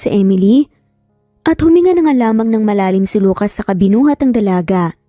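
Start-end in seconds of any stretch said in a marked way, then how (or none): none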